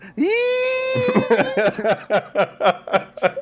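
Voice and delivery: long, very high sustained squeal; imitated song squeal. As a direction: mimic a screeching song ending, held for seconds.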